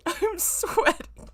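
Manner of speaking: high, slow, drawn-out through laughter; slow laughing groan. Real, not acted. Reader exhausted from laughing, slow high moan.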